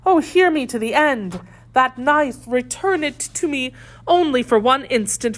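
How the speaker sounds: high, urgent plea for mercy; impassioned, supplicating captive woman; begging lady prisoner. A narrator's reading. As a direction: beg desperately, pitch held high. Voices Milady begging for mercy.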